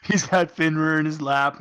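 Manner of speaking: normal talking voice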